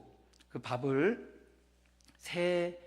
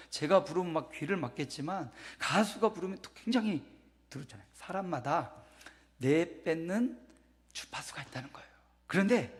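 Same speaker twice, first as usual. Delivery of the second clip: ordinary talk, nothing marked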